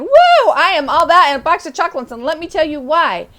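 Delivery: over-the-top confident cheer; enthusiastic. Shown as a demonstration. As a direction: burst out with a big proud woo and brag fast. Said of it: "really enthusiastic and open and saying".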